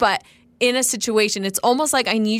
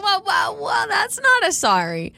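indignant objection; 9 semitones higher than usual. Acted. Acting out her objection to it.

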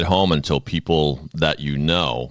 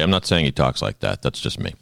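usual speaking voice

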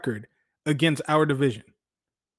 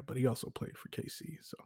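whisper; 16 dB quieter than usual. Real, not acted. Hushed aside about KC.

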